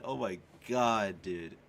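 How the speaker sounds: usual speaking voice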